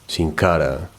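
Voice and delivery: usual speaking voice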